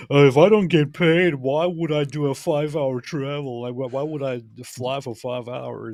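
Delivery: high, slow mock complaint; mocking the reluctant opponent. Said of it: "he's just like".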